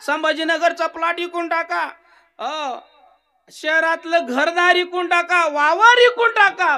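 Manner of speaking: a dying man bargaining loudly; frantic pleading old man. Acted. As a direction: plead for your life, offer everything.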